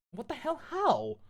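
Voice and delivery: high, startled outburst; screamed. Acted. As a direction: cry it out in shock. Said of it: "I screamed".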